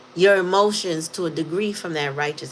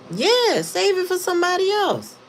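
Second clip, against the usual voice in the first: much higher, sing-song brush-off; scornful dismissal; 13 semitones higher than usual. Retold or imitated. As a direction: wave them off with high-pitched scorn. Dismissing someone who played her.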